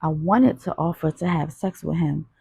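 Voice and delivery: usual speaking voice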